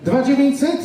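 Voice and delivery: ordinary talk, nothing marked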